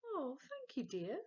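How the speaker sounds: lightly voiced kindly grandmother; old granny. Grandma thanking Henry, a lightly voiced doting granny.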